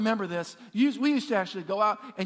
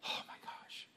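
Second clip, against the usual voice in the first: stunned whisper; 19 dB quieter than usual. Acted. Acted stunned reaction.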